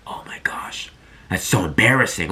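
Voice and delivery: hushed, voiceless mock dismay; mock whisper. Imagined student whispering over a harsh verdict, mock embarrassed. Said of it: "some might take that advice as like".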